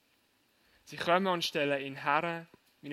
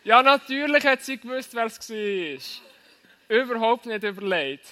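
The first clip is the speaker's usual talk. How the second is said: talking through laughter; loud, laughing admission; 6 semitones higher, 13 dB louder than usual. Real, not acted. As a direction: laugh out the words.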